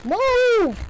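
high, loud yell of move; shouting. Real, not acted. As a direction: yell it at the screen. Yelling at game character.

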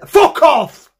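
sudden loud, high shout; yelled rebuff. Acted. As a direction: cut the request off with a shout. Cuts off imagined request.

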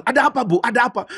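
high, urgent questioning; alarmed crowd. Acted. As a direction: rush in asking with alarm. Alarmed crowd rushing in, voiced.

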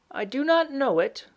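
put-on high-pitched old woman voice; old woman character.

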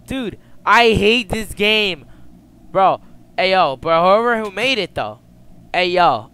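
loud, high exasperated rant; frustrated yelling. Real, not acted. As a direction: yell it at the game in frustration.

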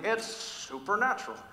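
TV guy impression. Preacher mimics that guy on TV, slow showy voice. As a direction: stretch out "supernatural" like a man on TV. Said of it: "I feel like that guy on T V".

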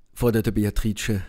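ordinary delivery

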